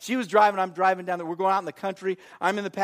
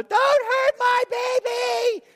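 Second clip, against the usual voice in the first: very high, drawn-out protective shriek; mimicked mother's yell; 3 dB louder, slower than usual. Mother yells from the stands at the boys' game, shrieking. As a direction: squeal it like an overprotective football mom. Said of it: "when she talked or she yelled out".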